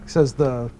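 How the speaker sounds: ordinary talk, nothing marked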